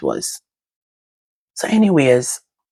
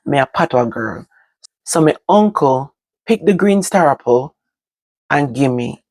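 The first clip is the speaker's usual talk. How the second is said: ordinary delivery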